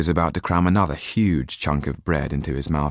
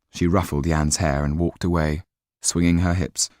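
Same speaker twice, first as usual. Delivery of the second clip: ordinary delivery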